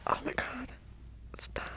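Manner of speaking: close, unvoiced whispered chat; ASMR whisper. Real, not acted. ASMR creator chatting to viewers in a whisper. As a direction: whisper intimately into the mic.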